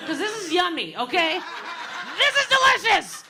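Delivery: high, loud excited dog voice; gleeful dog shouting with joy. Pretend. Voicing the dog.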